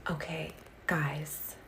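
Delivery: slow soft whisper; hushed, almost secret. Real, not acted. Narrating the dye to viewers in a whisper.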